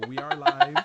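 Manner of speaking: high loud announcement; excited. Real, not acted. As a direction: shout it out like a host. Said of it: "announcing going live".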